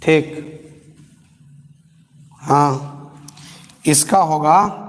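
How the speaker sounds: ordinary talk, nothing marked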